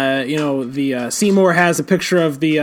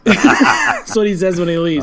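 ordinary talk

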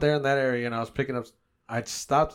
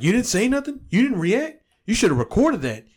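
mock outraged relatives, higher and louder; indignant family; 9 semitones higher, 6 dB louder than usual. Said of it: "And they're like".

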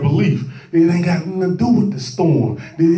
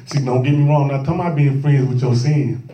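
usual speaking voice

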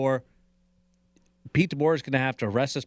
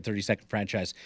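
ordinary talk

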